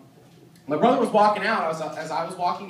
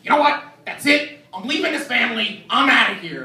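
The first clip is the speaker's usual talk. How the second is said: high, loud mock tantrum; acted indignant outburst, raised pitch; super pissed off; 4 semitones higher, 4 dB louder than usual. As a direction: imitate a boy storming out of the family. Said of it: "super pissed off about something".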